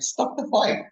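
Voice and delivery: reliving the yell at the screen; retold scream. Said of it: "I was screaming".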